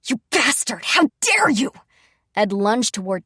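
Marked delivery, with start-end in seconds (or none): 0.1-1.7 loud, high, enraged shout; furious outburst. A narrator's reading. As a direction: hurl it at him as you lunge. Prince lunging at Dean.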